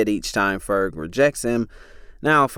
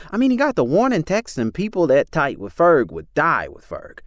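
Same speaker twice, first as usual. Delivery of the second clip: heated insistence; 8 semitones higher, 4 dB louder than usual. Acted. Chris arguing insistently.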